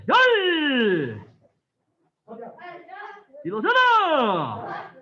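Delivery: loud Korean count, each number punched out; shouted drill count. Real, not acted. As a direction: call every count like a drill leader. Instructor shouting counts during drill.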